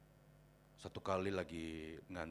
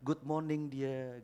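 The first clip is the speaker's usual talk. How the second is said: slow sing-song chapel greeting; affectionate greeting; 5 semitones higher, 3 dB louder, much slower than usual. Retold or imitated. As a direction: draw out each word fondly. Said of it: "saya selalu bilang begini".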